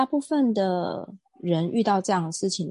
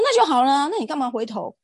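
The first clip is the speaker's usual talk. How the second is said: retold angry comeback; 7 semitones higher, 3 dB louder than usual. Her comeback in retold quarrel.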